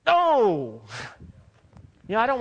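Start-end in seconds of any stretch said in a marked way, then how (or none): 0.0-0.8 emphatic no. Real, not acted. Sharp rejection of lukewarm faith.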